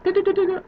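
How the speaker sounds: high-pitched rapid "du-du-du" noise; high little mental alarm noise; imitated thinking noise. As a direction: sound out a mind ticking over in a high pitch. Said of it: "my mind was like".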